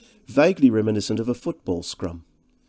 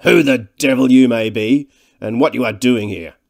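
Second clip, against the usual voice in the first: loud, forceful demand; big overbearing voice; commanding thick man; 2 semitones higher, 9 dB louder than usual. A narrator's reading. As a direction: challenge the intruder with full authority. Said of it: "His voice had all the qualities".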